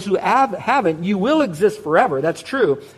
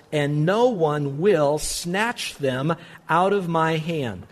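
slow scripture reading; 4 dB quieter, much slower than usual. Acted.

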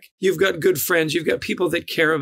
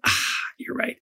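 whispered concession; 6 semitones higher, 4 dB quieter than usual. Retold or imitated. Friend conceding in retold exchange.

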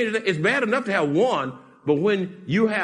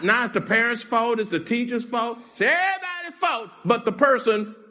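ordinary talk

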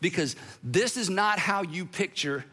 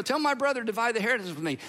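quick, run-together retelling; offhand rushed request; 3 semitones higher, much faster than usual. Retold or imitated. Man hoping this teacher can help, rattled-off request.

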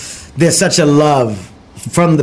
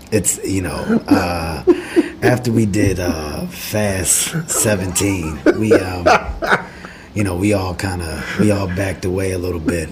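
low, quiet, dragging hushed voice; deep hushed Rock impression; 5 semitones lower, 3 dB quieter than usual. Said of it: "Why is your voice like that?".

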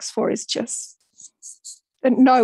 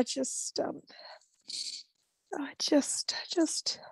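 fading to a whisper; 3 semitones higher, 13 dB quieter, slower than usual. Real, not acted. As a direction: voice trailing off into a whisper. Emotional pause, voice fading.

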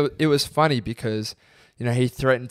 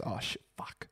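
whispered under the breath, caught out; a man realising he's lost, whispering to himself; panicked whisper; 13 dB quieter than usual. Retold or imitated. Retelling the fan cursing to himself in a whisper. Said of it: "He goes".

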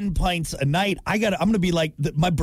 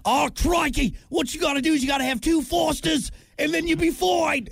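put-on higher, singsong Australian accent; mock Australian; 10 semitones higher than usual. Host mocking an Australian scientist in an Australian accent. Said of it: "They're not all Steve Irwins".

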